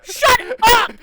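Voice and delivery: very loud, high shout; full-volume outburst breaking into laughter; exasperated yell. Real, not acted. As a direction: explode at a friend who won't stop laughing. Yells at laughing co-host.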